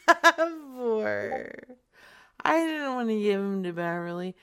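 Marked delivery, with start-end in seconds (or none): none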